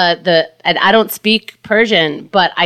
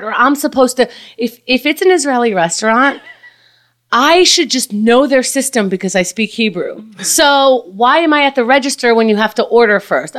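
ordinary talk

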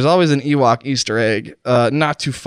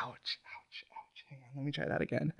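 tiny whispered ouches, barely audible; pained whisper; 24 dB quieter than usual. Pretend. Poisoned host hurting before retrying his sentence, pained. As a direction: recover from the pain almost without voice. Pain interrupts him mid-sentence.

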